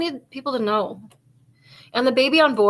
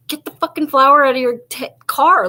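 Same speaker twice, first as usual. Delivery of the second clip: exasperated shout; 4 semitones higher, 7 dB louder than usual. Real, not acted. Scolding the girl in the video.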